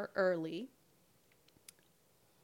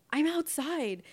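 mock-indignant, higher and louder; indignant retort; 7 semitones higher, 5 dB louder, slower than usual. Client answers her late beautician, indignant. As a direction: pitch up an exasperated reply. Acted text quarrel with beautician.

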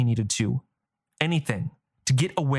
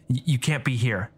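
stiffly. Acted. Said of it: "he said stiffly".

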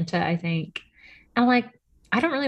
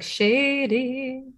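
sassy shady; 7 semitones higher than usual. Retold or imitated. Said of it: "I was like".

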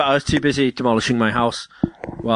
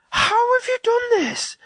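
much higher, slow incredulous question; stunned disbelief; slower than usual. Retold or imitated. Amazed at Ash ripping off the ledge, much higher and slow. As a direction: stretch it out in amazement. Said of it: "I'm like".

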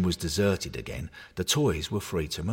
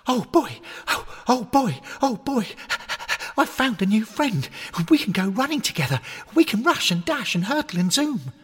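much higher, loud overjoyed puppy; excited dog; 4 dB louder than usual. Acted. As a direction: yelp it like a thrilled puppy.

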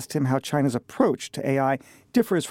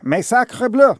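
loud, high French outburst; French chef; 6 semitones higher, 7 dB louder, slower than usual. Pretend. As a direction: exclaim like an outraged French chef. Mock French exclamation.

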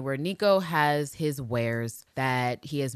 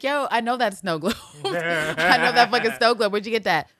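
laughing recognition; 5 semitones higher, 6 dB louder than usual. Retold or imitated. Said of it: "She's like".

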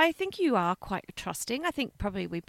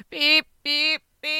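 loud, high vehicle beeps; mimicked warning beeper; imitated reversing beeps; 5 semitones higher, 6 dB louder, slower than usual. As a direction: beep like a reversing lorry.

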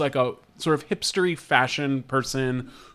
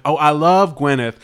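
put-on Southern accent, louder and gushing; mock Southern accent; 3 semitones higher, 5 dB louder than usual. Podcaster mimicking a Gwyneth fan at the health summit, mock Southern accent. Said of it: "we don't know that she had that accent".

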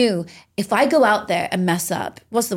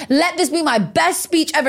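rising fervent plea; 8 semitones higher than usual. Retold or imitated. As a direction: build it up, climaxing the prayer. Retold prayer repeated, building.

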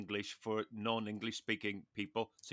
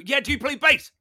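much higher and louder, bursting with eagerness; really excited; 11 semitones higher, 9 dB louder than usual. Shown as a demonstration. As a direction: fire the question back like a thrilled fellow musician. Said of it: "say it in a really excited voice".

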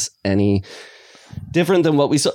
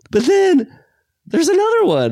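excited; 3 dB louder than usual. Real, not acted. Building up a surprise ending.

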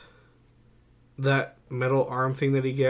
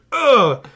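loud, high mock grunt of effort; 12 semitones higher, 11 dB louder than usual. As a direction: grunt as if stopping a sword. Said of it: "a little bit of effort into it".

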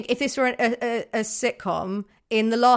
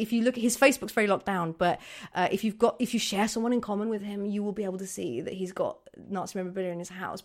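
fast, much softer rumour; hushed gossip; 6 dB quieter, much faster than usual. Acted. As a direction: gossip whispering details fast. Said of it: "so it'd be like".

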